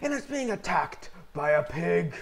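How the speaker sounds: hushed-then-rising wildlife narrator voice; nature documentary. Pretend. Skeleton in its natural habitat.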